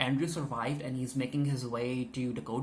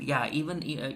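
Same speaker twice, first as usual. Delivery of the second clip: ordinary talk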